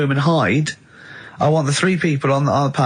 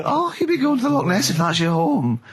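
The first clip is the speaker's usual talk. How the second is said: silly helpful stranger; 5 semitones higher than usual. Pretend. Passer-by answers the monster.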